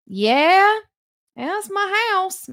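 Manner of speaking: put-on high, slow old lady drawl; spry old voice. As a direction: play a spry old neighbour.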